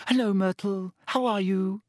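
high, sing-out friendly hello; careful greeting. A narrator's reading. Hermione greets the ghost in the stall, carefully friendly. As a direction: greet a touchy ghost sweetly.

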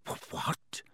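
breathless, whispered stammer; shocked, barely audible stammer. A narrator's reading. Boy stunned by the elf's warning, whispered stammer. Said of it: "Harry stammered".

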